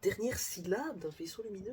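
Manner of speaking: soft, high, incredulous question; baffled repeat. Real, not acted. Puzzling over the riddle to himself, quietly baffled. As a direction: echo the clue under your breath, stumped.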